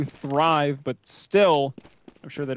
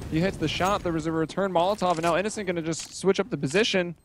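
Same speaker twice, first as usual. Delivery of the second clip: esports caster; 5 semitones higher, faster than usual. Acted. Live play-by-play, rising tension.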